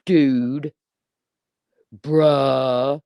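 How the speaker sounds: lightly voiced teen thug, drawn-out dude and bruh; mock a young thug's slang; a hint of a teenage thug voice; mock thug boy. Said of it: "You sound like a seventeen-year-old thug boy".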